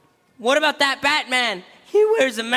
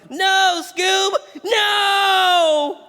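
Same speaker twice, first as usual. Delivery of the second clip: higher pleading cry of no; retold shout; 6 semitones higher, 3 dB louder, much slower than usual. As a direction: shout no at the dog.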